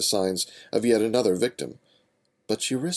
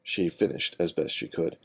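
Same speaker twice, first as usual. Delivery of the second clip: ordinary delivery, nothing marked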